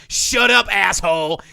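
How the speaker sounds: high, loud hammed-up yell at the driver; mock furious shout. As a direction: bark it like a rude woman losing it. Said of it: "And she's like".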